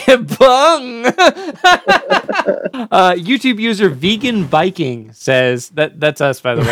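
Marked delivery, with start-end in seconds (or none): 1.1-2.9 high laugh. Real, not acted. Laughing at own joke.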